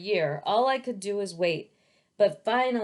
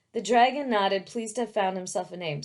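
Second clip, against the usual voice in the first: plain reading voice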